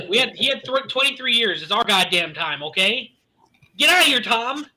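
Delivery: high-pitched, heated sports rant; worked up. Real, not acted. Co-host fed up with Tom Brady talk, worked up. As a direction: get fired up and send him packing with a sharp rise. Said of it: "I'm a little angry now".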